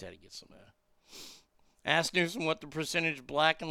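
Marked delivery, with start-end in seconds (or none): none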